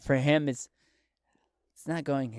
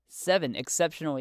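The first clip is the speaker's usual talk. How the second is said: normal talking voice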